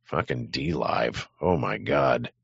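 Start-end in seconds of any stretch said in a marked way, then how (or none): none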